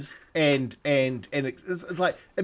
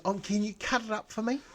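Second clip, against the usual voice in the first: prissy request; 7 semitones higher, 5 dB quieter than usual. Retold or imitated. Non-smoker voiced asking.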